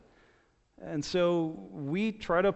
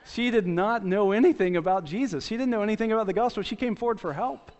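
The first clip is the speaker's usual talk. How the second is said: incredulous laugh; 5 semitones higher, 4 dB louder than usual. Real, not acted.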